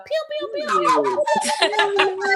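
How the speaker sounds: high, drawn-out zapping sound; pew-pew noises. Pretend. Imitated zap noises hyping the show.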